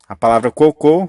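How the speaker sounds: cocô, final syllable hit hard; stress on final syllable. Shown as a demonstration. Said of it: "é uma oxítona".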